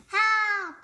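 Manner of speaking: loud, high cry of HELP; screaming for help. Acted. Beanie grabbed by the troll, screaming loud and high. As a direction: shriek it as the hand grabs you. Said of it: "Beanie screamed".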